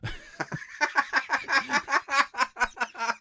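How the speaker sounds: a long, high, real burst of laughter. Real, not acted. Laughing at subtitle joke.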